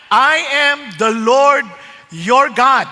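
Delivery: slow, weighty quote of the verse; solemn quotation. Acted. Preacher repeating God's words to the church, slow and heavy. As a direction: lean on every word.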